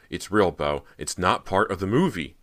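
loud, desperate insistence; losing control. A narrator's reading. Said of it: "feeling herself lose control".

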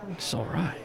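doubtful echo with a hint of Irish; quietly unimpressed Irish barman; Irish bartender. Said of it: "this strong-built Irishman".

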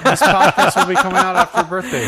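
talking through laughter; giggling while speaking fast; laughing. Real, not acted. Laughing while explaining the date.

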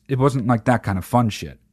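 ordinary talk

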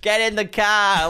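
put-on high, loud, slow Boston accent. Imitating the one guy in the trailer, Boston accent. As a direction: drag it out in a hammed-up Boston accent. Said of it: "no Boston accents in the trailer except for one guy's".

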